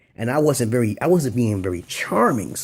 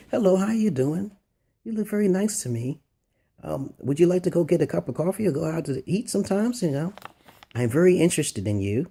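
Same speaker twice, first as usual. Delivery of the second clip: higher, soft, tentative chat-up voice; mock a timid, monotone pick-up attempt; demonstrated monotone approach; 5 semitones higher, 4 dB quieter than usual. Shown as a demonstration. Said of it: "if you talk in that tone".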